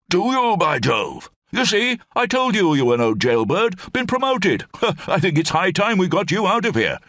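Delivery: put-on high, hearty old officer rambling on; blustering old brigadier. Old brigadier rambles to Lamb in the cell, high blustering voice.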